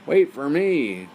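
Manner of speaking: slow, loud, high call after her; loud drawn-out call. Acted. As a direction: call after the running princess. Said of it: "called the frog".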